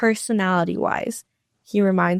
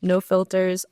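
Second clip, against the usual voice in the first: ordinary delivery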